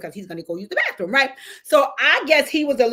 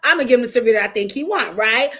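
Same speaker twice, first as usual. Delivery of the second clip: sassy retort; 3 semitones higher, 6 dB louder, faster than usual. Retold or imitated. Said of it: "So I said".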